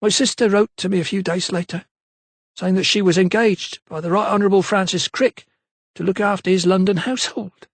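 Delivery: a lightly voiced London maid, unsure; maid's voice. A narrator's reading. Maid Eliza's voice.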